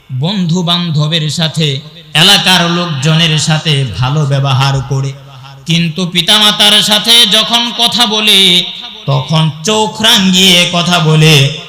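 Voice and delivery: usual speaking voice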